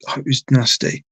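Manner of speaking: ordinary delivery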